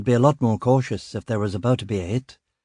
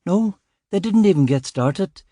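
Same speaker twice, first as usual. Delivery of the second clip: put-on higher, anxious young constable apologising; rattled junior officer, eager to please; flustered young constable; 6 semitones higher than usual. Constable excusing himself to his inspector, higher nervous voice.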